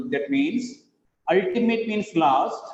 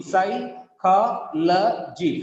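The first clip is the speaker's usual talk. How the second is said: very slow "psychology", psy, CHO, lo, gy; syllable by syllable; much slower than usual. Shown as a demonstration. Teacher counting syllables, "psychology" in separate pieces. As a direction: break "psychology" into four beats. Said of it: "Four syllables are there".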